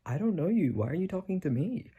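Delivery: réplique imitée nettement plus aiguë et outrée; mock defensive retort. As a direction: jouer le type agacé qui se défend. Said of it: "argument gets really aggressive and loud".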